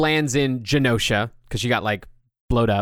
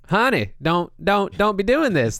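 higher Southern belle pleading, honey-sweet; Southern belle plea; 10 semitones higher, 4 dB louder than usual. Retold or imitated. Rogue begging Magneto to stop, Southern belle plea. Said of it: "like, you know".

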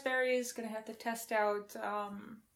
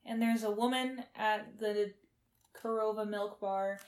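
ordinary delivery

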